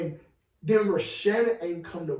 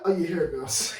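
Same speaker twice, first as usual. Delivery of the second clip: ordinary talk, nothing marked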